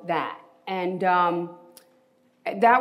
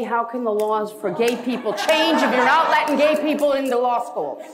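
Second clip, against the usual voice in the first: raised, insistent protest; impassioned plea; 4 semitones higher than usual. Retold or imitated. Demanding admission from the law school, impassioned. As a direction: make them hear the injustice. Arguing his case to admissions.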